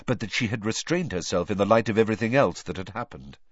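plain reading voice

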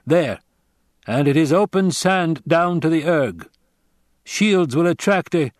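a grave stranger giving a warning; Fremen warrior. Acted. Narrator voices the Fremen.

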